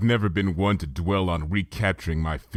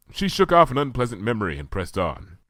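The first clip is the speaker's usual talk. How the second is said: plain reading voice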